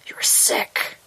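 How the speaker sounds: unvoiced dog whisper. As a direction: breathe it without voice. The dog says at last.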